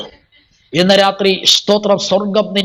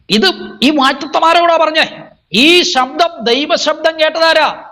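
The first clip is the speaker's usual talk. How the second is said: high, declaiming sermon voice; fervent preacher's declamation; full-force preaching; 6 semitones higher than usual. Acted. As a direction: proclaim it to the congregation on a high, sustained pitch.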